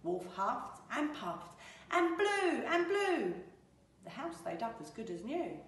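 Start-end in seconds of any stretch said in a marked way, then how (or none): none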